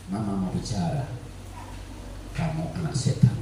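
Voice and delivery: very soft, low mock-tender mother; gentle but piercing. Shown as a demonstration. Mother softly insulting her child, hushed low demonstration. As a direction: murmur it gently, making the insult sting. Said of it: "Jangan lemah lembut. Tapi menusuk".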